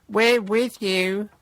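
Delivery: slow, high, fawning voice; gushing sycophant. Retold or imitated. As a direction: simper like a flunky.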